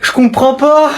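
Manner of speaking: very high, tearful protest; panicked cry. Acted. Boy acting scared of his cousin, very high and tearful. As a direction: whine it out, near tears.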